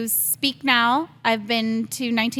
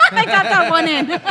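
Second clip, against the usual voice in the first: laughing; 8 semitones higher, 4 dB louder than usual. Real, not acted. Laughs about cursing.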